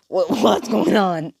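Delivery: choking, high and slow stammer; acted struggling. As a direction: splutter it out as if pinned down. Acted Deku pinned to the floor.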